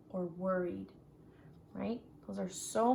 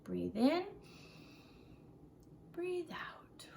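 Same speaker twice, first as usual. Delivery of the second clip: teacher leading breaths with the class; breathing exercise; 7 semitones higher, 8 dB quieter, slower than usual. Shown as a demonstration. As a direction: breathe out as a soft whisper. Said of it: "let's take some deep breaths together".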